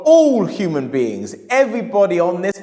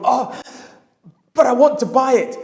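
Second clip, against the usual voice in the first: higher, louder, yearning; longing plea; 7 semitones higher, 3 dB louder than usual. Retold or imitated. Customer begging himself for the book, yearning. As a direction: ache to have it.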